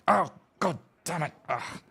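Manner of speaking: high-pitched exasperated curse, falling away; acted offstage cursing after a clatter; cursing smith.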